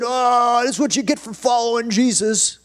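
loud, high-pitched mock complaint; hammed-up griping; mock grumbling. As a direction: wail it like a complaining believer. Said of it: "would have grumbled and complained".

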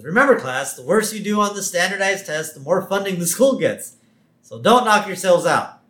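mildly acted classroom announcement; Mrs. Krabappel. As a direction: address the class before a test. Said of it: "Missus Krabappel's class".

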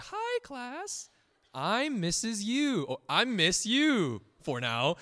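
very high, slow, syrupy teacher voice; sugary sweet teacher. Retold or imitated. Teacher greeting her new class, high and sugary. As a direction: gush like a cheery first-day teacher. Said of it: "They're like".

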